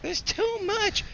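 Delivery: very high, slow squeaky kobold. Acted. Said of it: "do that kobold voice again".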